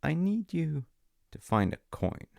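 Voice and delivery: ordinary delivery, nothing marked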